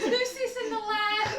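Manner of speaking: laughing. Real, not acted. Players echo joke.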